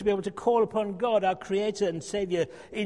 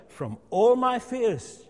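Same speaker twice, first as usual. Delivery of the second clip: FROM ALL MY FEARS drawn out, each word weighted; slow right down and lean on every word; slow emphatic answer; 4 semitones higher, 6 dB louder, much slower than usual. Shown as a demonstration.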